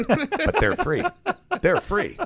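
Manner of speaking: high, slow, laughing through it. Real, not acted. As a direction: laugh at the absurdity. Laughing at twenty free bags.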